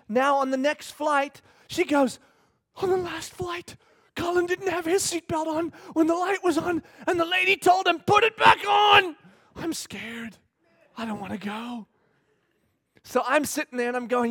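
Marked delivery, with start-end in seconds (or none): 2.8-9.2 put-on high, worried child; little girl voice. Daughter retells, high. 9.5-11.9 soft, frightened little voice; scared child. Retold or imitated. Daughter scared quietly.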